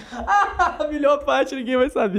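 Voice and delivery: high, slow laughing quip; laughing tease. Real, not acted. Host teasing the guest while laughing.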